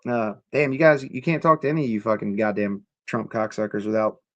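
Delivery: normal talking voice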